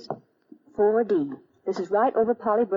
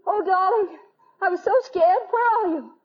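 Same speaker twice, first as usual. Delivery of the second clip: scared relief; 8 semitones higher than usual. Acted. Relieved yet frightened in dark.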